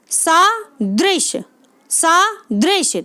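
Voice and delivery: सादृश broken in two, slow, with सा held apart from दृश; split pronunciation. Shown as a demonstration. Teacher showing students how to read it, word broken slowly in two. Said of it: "ठीक है, ऐसे पढ़ें इसको".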